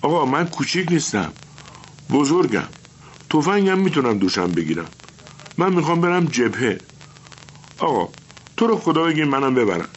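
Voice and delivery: put-on high, eager boy's voice begging his teacher; pleading young boy. Little boy begging his teacher to take him to the front, high and eager. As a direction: plead like a small boy who wants to go.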